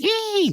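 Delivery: very high imitated creature squeal; mock Mothra screech. Explaining how Mothra attacks, high mock screech. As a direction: squeal like an attacking giant moth.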